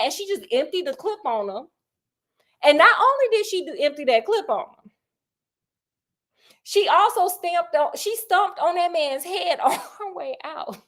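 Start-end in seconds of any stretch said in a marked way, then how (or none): none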